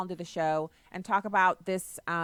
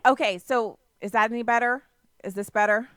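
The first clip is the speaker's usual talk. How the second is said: raised voice for bad line; louder; 3 semitones higher, 7 dB louder than usual. Real, not acted. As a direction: speak up so she hears. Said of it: "I can barely hear you".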